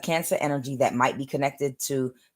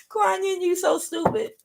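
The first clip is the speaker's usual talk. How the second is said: laughing. Real, not acted. Still amused, teasing Kuan Yin.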